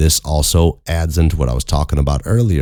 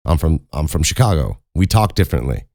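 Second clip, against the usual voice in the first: ordinary delivery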